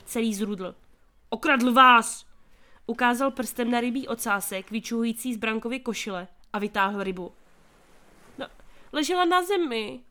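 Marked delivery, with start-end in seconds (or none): 1.3-2.2 shouted at the stallholder; loud accusation. A narrator's reading. Merchant accuses the boy. 8.4-10.0 stammering. A narrator's reading. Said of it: "koktal Branko".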